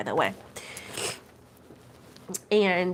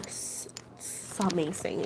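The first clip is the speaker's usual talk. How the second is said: breathy awe; 2 semitones lower, 9 dB quieter, much slower than usual. Real, not acted. Raving about Mexican food.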